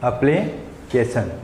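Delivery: एप्लीकेशन drawn out slowly, syllable by syllable; slow correct pronunciation. Shown as a demonstration. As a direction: spell out एप्लीकेशन slowly and carefully for students. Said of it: "शुद्ध pronunciation इसका होता है". (धीरे-धीरे सही उच्चारण: एप्लीकेशन)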